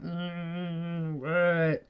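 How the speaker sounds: a mild mock grumble, half asleep; sleepy grouch. Woken from a nap, wife mildly grumbles at husband. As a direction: grumble it like you were just woken up. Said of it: "I didn't mean to be grouchy".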